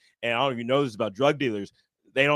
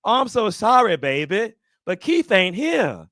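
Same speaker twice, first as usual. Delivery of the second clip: higher, drawn-out sugary grandma voice; sweet grandmother; 6 semitones higher, 6 dB louder, slower than usual. Retold or imitated. Grandmother answering the dealer at her door, sweet voice. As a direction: say it sweetly, slowing towards the end. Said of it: "is so sweet".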